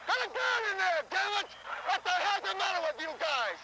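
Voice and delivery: furious sideline rant; angry shouting. Real, not acted. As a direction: chew the players out from the bench. Coach berating team from bench.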